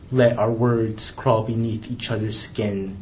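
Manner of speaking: ordinary delivery, nothing marked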